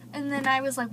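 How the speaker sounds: ordinary talk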